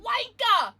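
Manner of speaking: put-on British-accented "Wanka!" shouted; mock British insult. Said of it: "what's some British slang".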